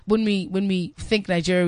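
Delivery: normal talking voice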